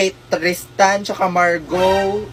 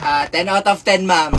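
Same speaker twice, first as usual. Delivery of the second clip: ordinary talk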